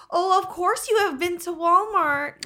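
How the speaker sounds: bad high slow Swedish accent; a clumsy try at a Swedish accent. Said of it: "I don't know how to do a Swedish accent".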